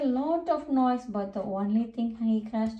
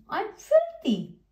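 dismayed; 10 semitones higher, 4 dB louder than usual. A narrator's reading. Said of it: "coughed Percy".

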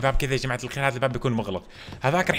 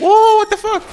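high, loud stunned yell; shocked shout; 13 dB louder, much slower than usual. Real, not acted. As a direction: blurt it out in total shock. Shocked by free loot. (صرخة دهشة عالية)

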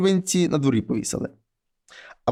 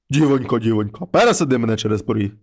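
low, heavy voice of a wolf head at the door; low monster head voice; 7 semitones lower than usual. Acted. Wolf head knocks and calls the girl, low and heavy. Mare head demands lifting over.

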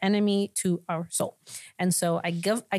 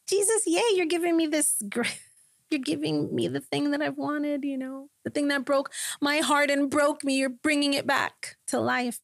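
high, elated retold cry to Jesus; acted-out overjoyed thanks; excited; 8 semitones higher than usual. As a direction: let the joy ride high, easing slightly as it goes. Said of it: "I was so excited".

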